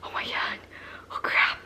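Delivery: hushed, dawning panic; whispered dread. Retold or imitated. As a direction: whisper it to yourself as it sinks in. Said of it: "my emotions that were going through my head".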